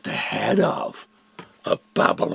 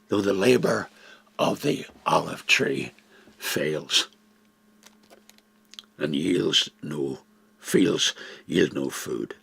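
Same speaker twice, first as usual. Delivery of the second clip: slow solemn scripture reading with long pauses; slow, hushed, reverent recital; 3 semitones lower, slower than usual. Acted. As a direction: read it like a psalm of trust. Reads Habakkuk's closing prayer.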